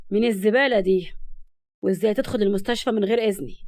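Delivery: forceful, contemptuous male boss; angry. A narrator's reading. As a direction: demand answers with contained fury. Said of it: "وقال بغضب لسبق".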